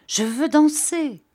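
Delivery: high, loud demand to dance; eager cry. A narrator's reading. Rosa bursting in, crying out high and loud. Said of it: "cria-t-elle".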